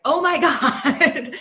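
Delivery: high and slow, laughing through the words; cracking up so hard the words stretch out; dying laughing. Retold or imitated. Winifred watching Obama react to Kiki, laughing hard. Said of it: "dying laughing".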